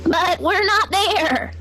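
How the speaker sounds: high, slow bleating lament; a sheep pleading, dragged out. A narrator's reading. Sheep notices it is missing, slow high bleat. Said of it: "bleated the sheep".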